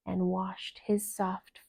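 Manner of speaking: ordinary delivery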